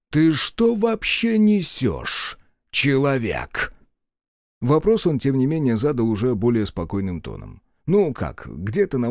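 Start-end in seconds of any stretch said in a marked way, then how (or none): 0.1-3.7 high, slow, incredulous anger; furious disbelief at the mortal; baffled rage. Acted. God baffled and angry.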